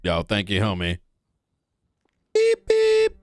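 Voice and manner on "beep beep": high, loud electronic beep; bomb timer beeps. Pretend. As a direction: mimic a bomb timer going off. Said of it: "What the hell did we run into?".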